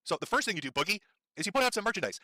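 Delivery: ordinary talk, nothing marked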